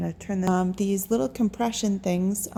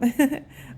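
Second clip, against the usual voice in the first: light laugh; 7 semitones higher, 3 dB louder than usual. Real, not acted. Laughs about forgetting words.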